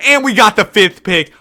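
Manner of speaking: ordinary talk, nothing marked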